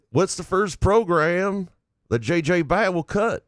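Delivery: a put-on Southern drawl, slowed; Southern accent. Shown as a demonstration. Co-host asked to read it Southern: mock Southern accent. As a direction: reads the question in a hammed-up Southern accent. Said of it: "In a Southern voice".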